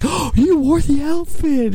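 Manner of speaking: very high-pitched excited gush, imitating her; mock excited. Co-host imitates her delight at seeing the outfit, excited squeal. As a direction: gasp and squeal it like a thrilled fan. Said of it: "she got all excited".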